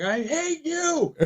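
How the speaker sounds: very high, drawn-out mock cry; retold tormented high squeal; anguished wail. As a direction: wail it slowly in a high, strained voice. Retold driver cries out, gagging.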